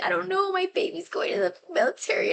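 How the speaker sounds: high, mock-weepy mom voice; imitated crying mom. Daughter mimicking mom upset about the military, mock crying. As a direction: wail it lightly, imitating a tearful mother. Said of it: "Why are you crying".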